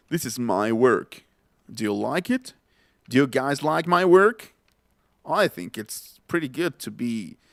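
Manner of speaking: slow, put-on big English voice; booming English voice. Teacher imitates someone with a bozarrón, booming English voice. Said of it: "alguien que tenga algún bozarrón en inglés".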